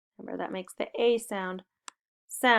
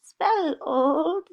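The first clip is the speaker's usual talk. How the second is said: put-on much higher, wavering old-person voice; little old voice; 9 semitones higher, slower than usual. Shown as a demonstration. Teacher to kids, in a wavering little old voice. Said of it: "use our little old voice".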